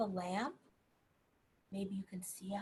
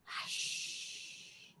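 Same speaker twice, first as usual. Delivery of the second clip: long, soft whispered shush; drawn-out voiceless "hush"; 5 dB quieter than usual. A narrator's reading. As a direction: whisper it like a quiet old lady shushing. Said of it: "who was whispering".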